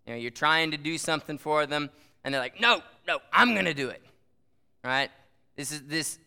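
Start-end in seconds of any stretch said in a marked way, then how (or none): 2.6-4.0 mock insistent child, higher and louder; insistent kid. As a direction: imitate a kid refusing help. Voicing stubborn small kids.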